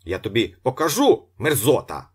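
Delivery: loud, high-pitched threatening yell; angry shout. A narrator's reading. Locksmith threatening the departing janitor, angry shouting. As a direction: shout threats at someone being carried away. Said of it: "закричав Віктор Михайлович".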